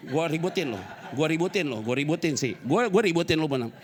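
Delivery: a loud, hammed-up telling-off; mock scolding. Pastor imitating himself loudly scolding his wife. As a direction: shout the threat half in jest.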